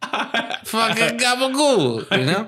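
slow, high, laughing New York Italian mockery; laughing mock New York Italian accent; thick New York Italian accent. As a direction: stretch out gabagool like a Jersey mobster. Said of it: "It was a pretty thick accent".

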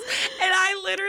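high, tearful; words wobbling through real tears; still crying. Real, not acted. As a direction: still choked up, trying to go on. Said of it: "didn't mean to get emotional".

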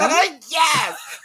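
high, laughing outburst; emphatic yes. Real, not acted. Host confirming sibling beatings, real emphatic outburst. Exclaiming about being beaten up.

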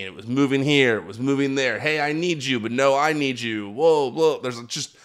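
usual speaking voice

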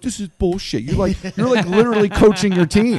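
buddies' mock outrage. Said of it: "They're like".

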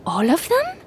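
high, astonished question; can't believe what she heard; surprised question. Acted. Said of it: "repeated Nadia".

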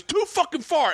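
very high-pitched mock yell; angry heckler. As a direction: imitate a heckler escalating his protest. Retold heckler escalates.

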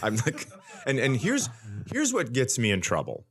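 normal talking voice

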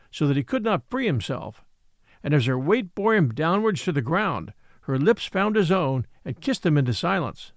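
usual speaking voice

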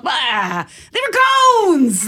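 loud, drawn-out playful yell; yelled catchphrase. Retold or imitated. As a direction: yell it like a silly inside joke. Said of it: "one of us will just yell".

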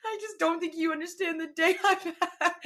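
high, hammed-up tearful wail; mock weeping. Customer weeping to bank clerk, mock sobbing. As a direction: imitate sobbing through the words, breaking into laughter. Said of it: "started weeping".

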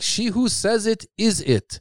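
high, slow, prim schoolyard comeback; playful imitation of mom's prim comeback; mom's childish comeback. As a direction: lightly voice a mother's gentle retort. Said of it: "my mom doesn't like to cuss".

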